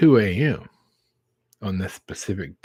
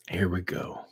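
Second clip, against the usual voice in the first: hushed aside; 3 semitones lower, 5 dB quieter than usual. Retold or imitated. Bracing at the drive-thru lane.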